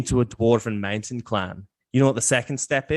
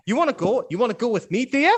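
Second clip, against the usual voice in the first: much higher rowdy dwarf goading; taunting dwarf; 12 semitones higher, faster than usual. Acted. Dwarf taunting the newcomer to fight him, high and rowdy. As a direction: needle him, spoiling for a fight.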